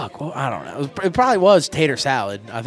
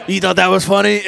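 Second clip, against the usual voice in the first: a hint of a louder, higher self-heckler; lightly mock yourself, pitched up and louder; inner-critic voice; 6 semitones higher, 7 dB louder than usual. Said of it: "that is what I sound in here".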